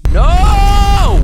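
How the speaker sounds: long, loud, high-pitched wail; mock horrified scream. Gamer fooled into reading aloud, mock horrified scream. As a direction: bellow one drawn-out cry of despair. Mock horror at the game's text.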